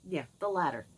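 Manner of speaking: usual speaking voice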